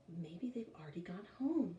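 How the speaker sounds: soft unvoiced hopeful whisper. A narrator's reading. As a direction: breathe it out hoping to escape. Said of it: "Jeff said hopefully".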